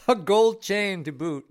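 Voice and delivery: laughing through the words, high and loud; giggling punchline. Real, not acted. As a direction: crack up while saying it. Russian bear joke, laughing.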